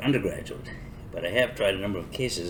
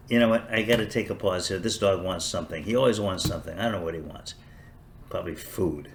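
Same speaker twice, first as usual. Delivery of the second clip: normal talking voice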